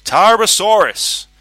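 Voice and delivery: high, loud, slow drawn-out name; showman's announcement. A narrator's reading. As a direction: announce it like a circus ringmaster. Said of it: "Vanzetti announced".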